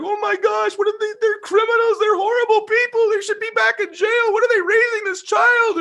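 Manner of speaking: mother outraged at the neighbors, high falsetto shriek; mom's outrage. Retold or imitated. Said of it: "Mom's like".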